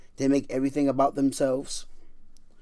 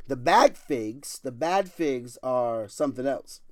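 slow emphasis; slower than usual. Acted. Preacher slowing on bad figs.

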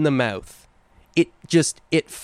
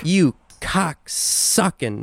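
drawn-out insult; 4 semitones higher, much slower than usual. Acted.

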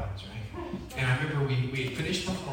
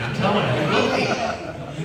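preaching emphasis; 3 semitones higher, 8 dB louder than usual. Real, not acted. Preaching about heaven.

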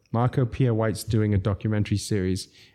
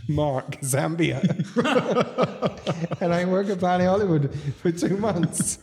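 high voice bubbling with laughter; real amused laughter through the words; laughing while talking; 7 semitones higher than usual. Real, not acted. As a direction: tell it while cracking up. Cracking up at name badge.

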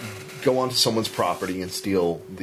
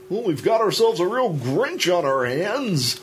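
high, singsong mock newscaster; news anchor impression; 7 semitones higher than usual. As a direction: parody a cheesy TV news line. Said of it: "will say".

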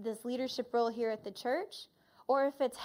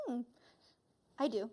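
ordinary delivery

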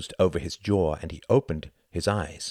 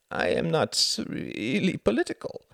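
timid; 11 semitones higher, 3 dB louder than usual. Acted. Timid Klaus.

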